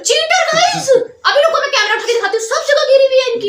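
high-pitched outraged squeal with laughter; laughing accusation. Real, not acted. Challenger tells viewers her rival cheated, high laughing protest. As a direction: protest excitedly, voice shooting up.

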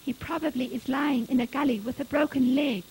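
ordinary delivery

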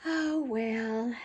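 mildly let-down little dog; resigned sigh. A narrator's reading. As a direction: let it go with a long, resigned sound. Petey shrugs off a refusal.

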